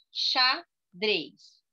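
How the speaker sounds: "Xadrez" broken into two separate beats; syllable by syllable. Shown as a demonstration. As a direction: say "Xadrez" as xa, pause, drez.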